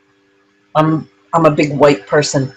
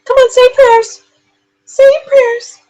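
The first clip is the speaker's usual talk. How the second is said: very high, sing-song pet voice; baby talk to a dog; 4 dB louder than usual. Acted. Owner coaxing her dog Charlie to pray, high baby talk. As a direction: coo at the dog like a toddler.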